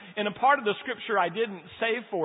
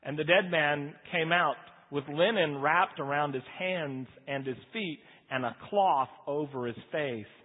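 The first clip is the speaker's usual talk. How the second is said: scripture reading; 4 semitones lower than usual. Acted. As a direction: ease back into reading the passage.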